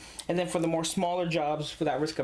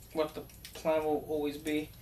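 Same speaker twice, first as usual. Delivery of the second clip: ordinary delivery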